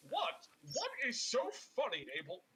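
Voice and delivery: high, affronted demand; indignant pompous adventurer. Acted. Alabaster asking Abel what is funny, indignant. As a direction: demand an answer, pitched well up.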